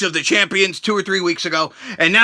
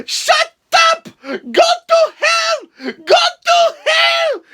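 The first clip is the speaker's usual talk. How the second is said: much higher, slow shrieking mock yell; Lana screaming; much slower than usual. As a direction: scream it at him like a furious wife. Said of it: "and she's like".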